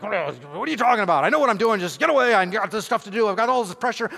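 overloaded husband snapping back, leave me alone; snapping at wife. Retold or imitated. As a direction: push her away with rising irritation. I'm like.

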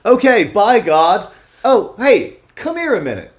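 calls out loud and high; loud calling out. Acted. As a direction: shout across the water. Noah calls to the whale.

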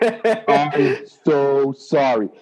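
slow sorry breaking into laughter; laughing apology. Retold or imitated. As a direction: apologize while cracking up. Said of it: "is Joe goes".

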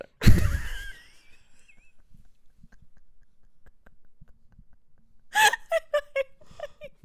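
long, high, breathless laughing fit; helpless laughter. Real, not acted. Cracking up at the friend's dog confession, helpless laughter. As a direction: crack up loudly and keep going. Cracks up at own retold line.

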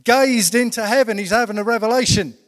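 high, loud sermon delivery with weighty emphasis; full-force preaching. Acted. Preacher telling the congregation of Stephen's vision, full-force preaching.

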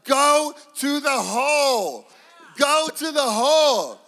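high, loud, slow drawn-out yell; dad bellowing from the sideline. Retold or imitated. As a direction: stretch each word across the gym. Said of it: "I'll yell out".

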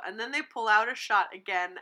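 ordinary delivery

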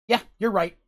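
flatly agree, no sarcasm; serious agreement. Acted. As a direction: Kip agreeing with Rand in earnest. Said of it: "he says it seriously".

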